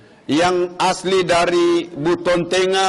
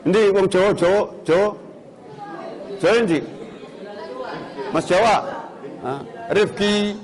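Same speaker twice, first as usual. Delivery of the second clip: Javanese calling out. Retold or imitated. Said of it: "sedikit halus juga".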